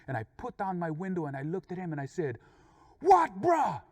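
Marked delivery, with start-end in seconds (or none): 3.0-3.8 high, loud confrontational yell; angry Pidgin challenge. Retold or imitated. Driver yells out his window while passing, angry challenge. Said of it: "tell him exactly what I was feeling".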